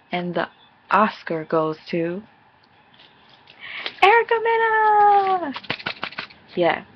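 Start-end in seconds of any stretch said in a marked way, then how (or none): 0.9-5.5 much higher, louder announcer shout; a hammed-up awards-night cry; mock award announcer. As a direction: announce the winner like the Oscars.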